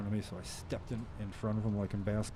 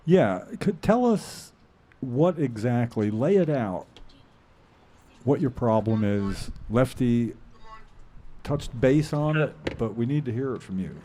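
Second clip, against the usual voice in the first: louder, projecting into the mic; speaking up; 6 dB louder than usual. Real, not acted. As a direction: speak up so the caller hears. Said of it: "Can you try to speak up".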